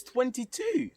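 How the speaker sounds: high-pitched imitation of surprise; surprised exclamation. Teacher reacts to her age.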